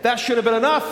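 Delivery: now push it out hard; emphatic. Real, not acted. Preacher hammering home that it should have sufficed, loud.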